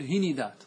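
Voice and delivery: هندات as hi-ni-dat; three syllables in هندات, "i" twice; vowel-echo plural. Shown as a demonstration. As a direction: copy the "i" onto the ن in هندات. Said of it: "تتبع الحرف الثاني حركة الحرف الأول".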